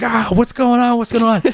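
high panicked elf voice; confused elf. Acted. GM voicing a feathered elf, high and panicked. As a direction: blurt it out like a blinded, flustered elf. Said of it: "He's like".

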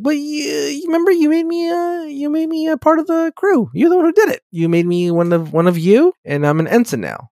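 whiny Wesley. Retold or imitated. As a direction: plead like a bratty teen. Wesley pleading with Riker.

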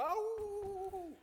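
long, high-pitched howl; wolf howl. Retold or imitated. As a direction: howl like a smitten cartoon wolf. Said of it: "turn into a wolf and you go".

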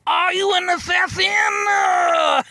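high, slow shouted wrestling promo; Dusty Rhodes promo. Retold or imitated. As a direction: bellow it slow like a crazed wrestler. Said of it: "it goes a little something like this".

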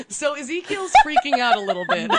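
high, loud, laughing narration. Real, not acted. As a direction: tell it while cracking up. Breaks up laughing while narrating.

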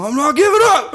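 high, loud defiance edged with laughter; mock defiance. Knocked down by his boxer friend, mock defiant. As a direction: refuse to stay down, hammed up. Retold refusal to stay down.

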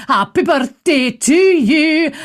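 birthday song in a Scots accent. Shown as a demonstration. Host singing to friend in Dundee, Scots accent. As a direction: sing it slowly with a put-on Scots accent. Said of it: "I'll do it in a Scots accent".

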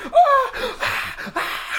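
high-pitched imitated panicked screaming; mock scream. Retelling Miss Peacock reacting to poison, mock scream. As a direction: shriek in mock panic, half laughing. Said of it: "stop her from screaming".

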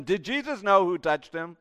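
normal talking voice

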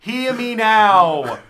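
slow, loud witch's command; witchy incantation. Pretend. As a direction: intone it like a witch casting a spell. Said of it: "Are you using witchcraft".

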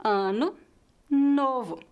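"ano", "novo" separated, each ending in light U; final O as weak U. Shown as a demonstration. Teacher showing both words, "ano, novo" ending in weak U. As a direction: stress the start, close "ano" and "novo" on weak U. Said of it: "Isso acontece nas duas palavras".

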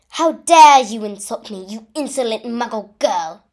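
loud, indignant outburst; outraged villain. A narrator's reading. Voldemort insulted by a muggle girl, outraged. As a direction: explode at the insult. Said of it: "and yelled a curse".

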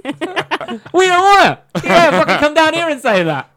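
high-pitched mock outrage; mock indignant yelling. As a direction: yell at noisy cats in mock anger. Acted shouting match with fighting cats.

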